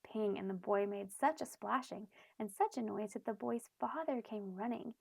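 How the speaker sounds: usual speaking voice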